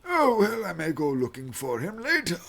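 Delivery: put-on high, frail old man voice; quavering elderly temple master.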